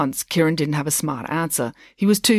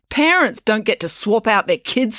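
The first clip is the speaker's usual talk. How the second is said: higher, irritated snap; snapping; 6 semitones higher, 4 dB louder than usual. A narrator's reading. April snaps back at classmates' teasing, irritated. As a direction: snap it back at them, pitch up. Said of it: "snapped April".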